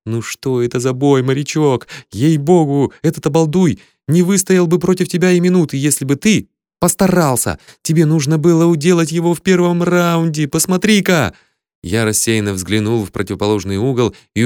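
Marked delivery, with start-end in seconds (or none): 0.1-11.3 mild grumbling, climbing higher as it goes; lamenting. A narrator's reading. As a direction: complain to your fighter, voice creeping up. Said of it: "мой секундант всё сетовал". (сетовать, постепенно повышая голос)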